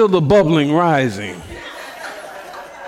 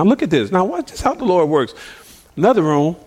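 ordinary delivery

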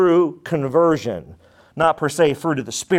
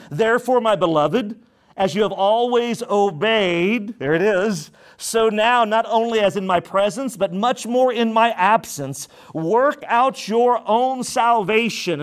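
raised, measured preaching of the verse; scripture reading; 6 semitones higher than usual. Acted. Pastor reading scripture to the church, raised and measured. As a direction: read the Word out to the congregation.

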